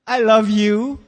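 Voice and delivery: loud, drawn-out acted "I love you"; strained declaration of love. Spouse reacting to morning breath.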